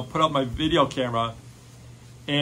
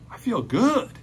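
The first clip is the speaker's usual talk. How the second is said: surprised; 8 semitones higher than usual. Retold or imitated. Girl realizes healing.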